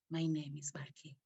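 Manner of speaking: soft whisper of a small inner voice; little inner voice. Retold or imitated. Inner voice whispering her name to her. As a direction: breathe the name like a tiny voice inside. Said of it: "a little voice inside of me said".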